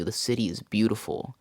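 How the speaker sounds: ordinary delivery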